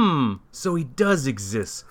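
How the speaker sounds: plain reading voice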